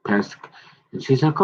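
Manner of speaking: ordinary talk, nothing marked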